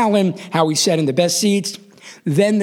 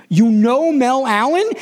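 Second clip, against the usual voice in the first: slow, high-pitched disbelief; acted-out kid who can't believe it; incredulous; 6 semitones higher than usual. Child astonished by dad's friend.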